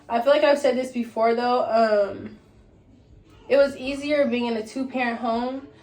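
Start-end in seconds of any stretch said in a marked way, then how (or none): none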